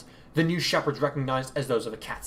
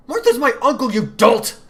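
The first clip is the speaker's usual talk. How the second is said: much higher, louder contempt; spat insult; 12 semitones higher, 12 dB louder than usual. Acted. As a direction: spit the correction. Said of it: "Oliver spat".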